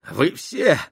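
high, loud breathless cry; gasping. Acted. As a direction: gasp the words out in panic. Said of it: "задыхаясь".

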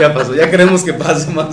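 laughing through the words. Real, not acted. Host laughing as he jokes the problems are over. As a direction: joke it out while still laughing. Joking about past difficulties.

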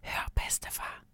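very soft whisper; hushed, secretive call. A narrator's reading. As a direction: whisper to get his attention. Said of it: "hvisker jeg".

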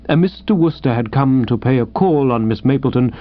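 usual speaking voice

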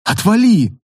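a touch of tearful snap; half-sobbed telling off; sobbing snap. A narrator's reading. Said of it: "всхлипнула проводница".

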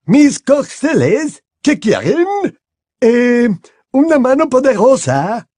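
a lofty headmistress, unhurried; Madame Maxime voiced.

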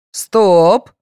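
loud, drawn-out order; commanding. A narrator's reading. Policeman stopping departing contestants, commanding. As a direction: halt them with one firm word. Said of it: "скомандовал Савченко".

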